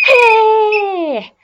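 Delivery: long, slow, very high loud whoop; drawn-out cheer. Acted. Yusuf and Omar cross the finish line, a long high cheer. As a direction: cheer the win. Boys win the race.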